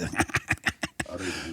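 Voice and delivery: low-volume staccato chuckle; imitated giggle. Sidekick reacting to the boo, soft imitated giggle. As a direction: chuckle softly like her sidekick. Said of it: "And he like".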